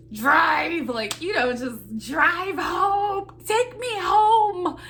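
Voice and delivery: wife whining at husband to hurry home, high and loud; whiny demand. Retold or imitated. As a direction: beg him to just drive home, dragging each word. Impatient wife urging husband home.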